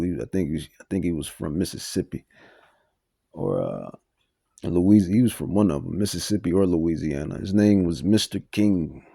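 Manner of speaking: ordinary talk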